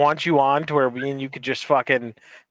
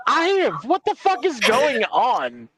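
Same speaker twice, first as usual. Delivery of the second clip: higher, slow, baffled mock cry; mock bewildered outburst; 7 semitones higher, slower than usual. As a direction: play dumb at everyone yelling, slowly. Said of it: "and I'm like".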